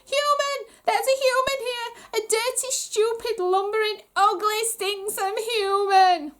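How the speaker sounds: high, loud terrified ranting; high, loud hysterical goblin; panicked goblin shrieking. Acted. Goblin prince panics at human.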